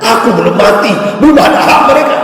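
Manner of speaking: high, loud indignant outburst; father yelling he is still alive; furious shout. Acted. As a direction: bellow it at the heirs lined up with pens.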